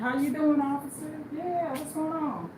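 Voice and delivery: high, sweet, polite greeting; overly polite, high greeting; polite to police. Retold or imitated. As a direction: act extra nice for the officer. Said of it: "you can't be talking crazy to the police".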